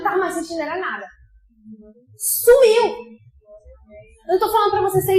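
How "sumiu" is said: high, loud burst of joy; excited cry. Real, not acted. Preacher telling the church her cold vanished, joyful shout. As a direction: shout it with delight.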